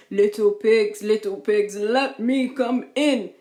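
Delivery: loud, slowing wolf demand. A narrator's reading. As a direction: demand entry as the big bad wolf. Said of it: "demanded the wolf".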